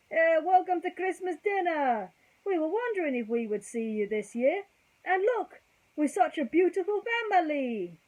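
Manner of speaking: put-on high, loud jolly Italian accent. Tony welcomes the family to Christmas dinner, loud hearty Italian accent. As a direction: welcome them like a beaming Italian restaurateur. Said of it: "Apologies for the Italian accent".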